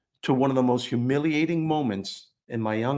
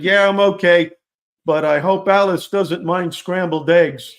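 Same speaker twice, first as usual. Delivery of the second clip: yelled out; 6 semitones higher, 8 dB louder than usual. Retold or imitated. Boy behind the wall shouting to the audience, loud. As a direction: shout the joke to the whole hall. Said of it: "I yelled out as loud as I could".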